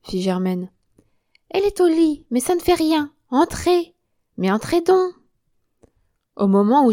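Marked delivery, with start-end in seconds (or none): 1.5-5.1 high, loud and pressing; insistent invitation. Acted. Woman urges Germaine to enter, high and insistent. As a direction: urge the visitor inside.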